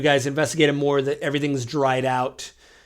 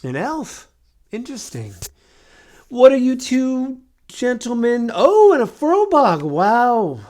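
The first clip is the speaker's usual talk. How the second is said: put-on high, wondering old voice, rising louder; curious mummy; 8 semitones higher, 5 dB louder than usual. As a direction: marvel at each stranger in a high voice. Mummy squints at party members.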